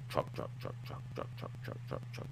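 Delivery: whispered rhythmic engine chug; truck impression. Shown as a demonstration. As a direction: chug the word softly, over and over. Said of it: "this is my impression of a truck".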